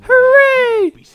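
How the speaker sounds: put-on high, slow shouted kid cheer; squeaky Tails cheer. Gamer voicing Tails celebrating, high and shouted. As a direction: crow over the win in a tiny voice. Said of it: "And Tails is like".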